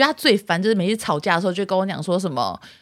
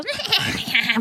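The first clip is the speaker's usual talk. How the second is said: a higher, hammed-up sitcom-style laugh; imitating canned laughter; 9 semitones higher, 3 dB quieter than usual. Shown as a demonstration. Showing the canned laugh in husband's videos, higher mock laughter. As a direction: mimic the laugh track she hates. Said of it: "笑成这样子的".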